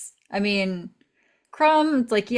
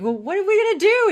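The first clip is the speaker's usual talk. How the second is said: high, loud and fast; panicked character; 10 semitones higher, 6 dB louder, much faster than usual. Retold or imitated. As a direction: blurt it out in a panic. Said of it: "He's like".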